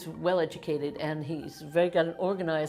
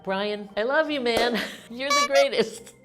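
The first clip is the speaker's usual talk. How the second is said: louder, higher gush with a laugh; affectionate shout-out; 5 semitones higher, 6 dB louder than usual. Real, not acted. Woman cheering her good friend Brian, gushing and loud. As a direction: call out your love to a friend, laughing.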